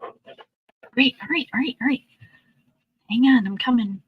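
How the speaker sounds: high, eager pet voice; talking to a dog. Real, not acted. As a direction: answer him up high. Answering the dog.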